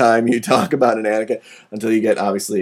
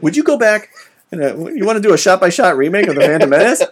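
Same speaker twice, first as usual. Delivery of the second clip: hypothetical quote; 7 semitones higher, 4 dB louder, faster than usual. Retold or imitated.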